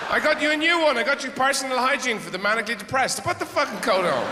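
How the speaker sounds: hammed-up pushy outrage; mock exasperated rant. Gift giver ranting at partner.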